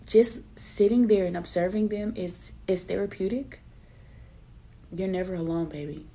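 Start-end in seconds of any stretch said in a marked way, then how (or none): none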